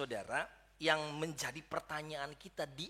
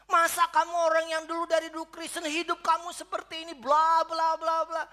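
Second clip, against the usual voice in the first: judgmental scolding; 10 semitones higher, 13 dB louder than usual. Retold or imitated. Church folk scolding the returnee.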